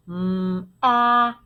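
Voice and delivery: "Mm, ah" as separate held phonics sounds; sounded out. Shown as a demonstration. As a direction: hum the "Mm", then open into a short "ah". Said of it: "I hear three sounds".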